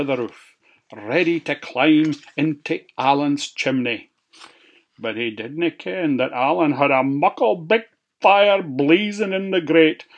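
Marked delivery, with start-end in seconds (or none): none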